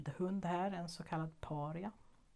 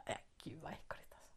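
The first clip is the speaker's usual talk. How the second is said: disgusted whisper; 12 dB quieter, faster than usual. Real, not acted. Disgusted by the moth in her hair.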